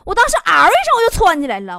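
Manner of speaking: usual speaking voice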